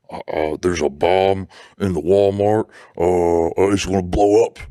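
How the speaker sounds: lightly put-on fake caller voice; a hint of a bad disguised voice. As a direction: mimic a hesitant disguised caller. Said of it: "someone talking like that".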